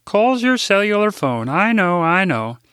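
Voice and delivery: put-on high, eager older Midwestern lady; chirpy assistant butting in with news; Rose's voice. Rose finishes his sentence.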